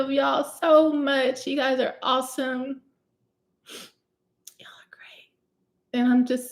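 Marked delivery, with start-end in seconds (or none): 4.6-5.3 soft breathy whisper. Real, not acted. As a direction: whisper it like a little secret. Aside to viewers.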